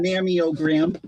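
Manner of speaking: "mammiogram" with an extra ee, MAM-ee-oh-gram; quoting the botched "mammiogram"; mother-in-law's mispronunciation. Retold or imitated. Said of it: "My mother-in-law says".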